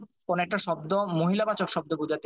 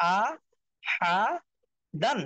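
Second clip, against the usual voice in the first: আ, হা, দান each said alone with a pause; broken-up syllables; 4 semitones higher, much slower than usual. Shown as a demonstration. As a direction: split it into আ ... হা ... দান. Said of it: "ভাঙ্গা উচ্চারণ করবেন".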